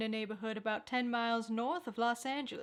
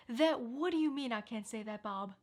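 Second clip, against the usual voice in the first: ordinary talk, nothing marked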